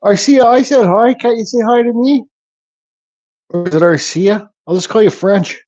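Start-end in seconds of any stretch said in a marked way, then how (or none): 0.0-2.2 playful pouty whine at a silent chatter; mock hurt. Real, not acted. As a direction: tease the viewer for ignoring you. Teasing a chat viewer for no greeting.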